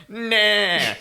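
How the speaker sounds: high, loud mock deer groan; imitated deer cry. Retelling a podcast story, imitating the hit deer's cry. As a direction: voice the dying deer's wail. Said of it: "the noise he makes is, like, the deer's just laying there going".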